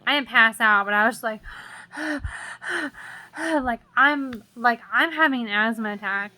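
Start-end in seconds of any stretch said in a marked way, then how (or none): 1.4-3.3 long breathy unvoiced sigh; exhausted sigh after a scare; heavy sigh. Real, not acted. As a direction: let out a drained, shaky breath. Overwhelmed after an asthma attack.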